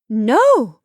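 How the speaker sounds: loud, high cutting interruption; emphatic no. A narrator's reading. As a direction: slam the word No, down to stop him. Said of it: "interrupted Marcia emphatically".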